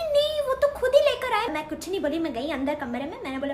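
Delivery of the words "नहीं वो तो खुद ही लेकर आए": mimicking Sushma. Aunt imitates Sushma.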